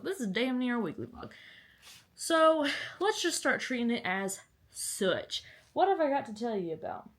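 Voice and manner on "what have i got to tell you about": ordinary talk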